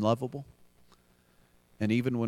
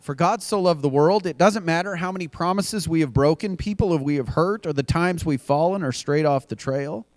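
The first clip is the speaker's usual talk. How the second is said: louder, pressing preacher build; rising sermon appeal; 5 semitones higher, 4 dB louder than usual. Acted. Pastor urging the congregation, building preaching. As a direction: lift it like an altar call.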